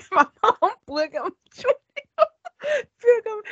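high, breaking up with laughter; laughing. Real, not acted. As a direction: squeak it out through laughter. Laughing too hard to speak.

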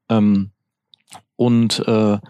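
trailing off. Real, not acted. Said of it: "Ich hab gerade den Faden verloren".

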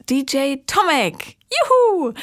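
high, delighted whoop; excited cheer. Real, not acted. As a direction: cheer the guest in with joy. Host cheers for her guest.

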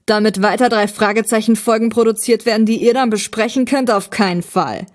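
loud, indignant villain refusal; theatrical villain. Acted. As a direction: refuse like an aggrieved arch-enemy. Voices the story's villain.

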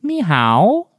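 high, slow talking owl; toy owl voice. Retold or imitated. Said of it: "Vor jedem Tipp".

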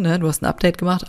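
ordinary talk, nothing marked

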